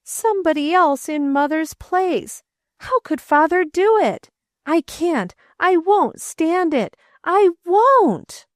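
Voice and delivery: put-on a grieving boy refusing; boy's angry outburst. A narrator's reading.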